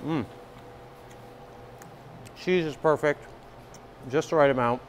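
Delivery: talking between chews; muffled by pizza bite; mouth full while chewing. Real, not acted. Seen 0: Bites slice at -3, lips closed, chewing through labelled words.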